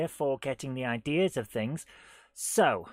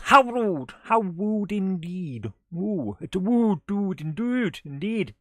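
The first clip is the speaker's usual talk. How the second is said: hammed-up indignant; 2 semitones higher, 3 dB louder, slower than usual. Reading a chat joke in a voice.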